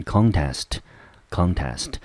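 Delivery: contest stressed on CON; CON-test, first syllable strong with an open "ah". Shown as a demonstration. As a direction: hit CON in contest, let test fall. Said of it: "中音节落在第一个音节".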